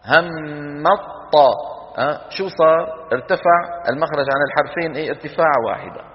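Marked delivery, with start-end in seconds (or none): 0.1-1.5 هم الطا drawn out very slowly, the م merged into ط; slow merged demo. Shown as a demonstration. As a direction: stretch هم الطا to show the merge. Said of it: "لما منقول هم الطا". (إدغام ممدود ببطء في هم الطا)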